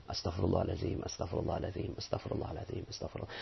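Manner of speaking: fading to a whisper, humble; sincere whispered astagfirullah; whispered istighfar. Shown as a demonstration. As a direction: whisper it in repentance. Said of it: "Maka pengucapan istighfar menjadi benar".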